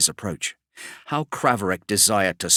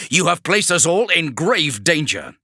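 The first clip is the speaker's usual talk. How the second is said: higher and louder barked battlefield orders; commanding warsmith; 8 semitones higher, 4 dB louder than usual. Acted. Kravarek orders his men to cover the demigod, loud and commanding. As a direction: bark it across the hold to your squads.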